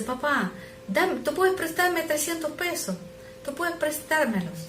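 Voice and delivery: put-on high, timid little boy asking again; child's voice. Son asking his father again for money, high child voice.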